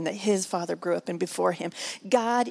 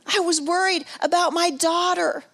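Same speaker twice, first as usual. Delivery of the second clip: ordinary talk, nothing marked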